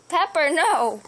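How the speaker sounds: loud sharp no at the cat; scolding shout. Real, not acted. Owner yelling at Pepper for biting, loud scolding. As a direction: stop the cat with a firm yell.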